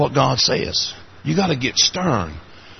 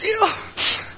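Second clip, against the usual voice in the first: very high, squeaky mock-tearful voice; imitated sobbing; 4 dB quieter than usual. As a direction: squeak it out as a hammed-up sob. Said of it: "he's just sobbing".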